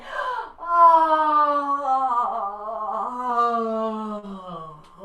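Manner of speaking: long loud yawning sound; big dog yawn. Shown as a demonstration. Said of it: "nice yawning dog".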